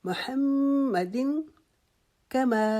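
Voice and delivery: high, very slow chant of Muhammad; tajweed recitation. Shown as a demonstration. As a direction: recite وعلی آل محمد, drawing every vowel out. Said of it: "اس کو لمبا ساتھ کرنا ہے".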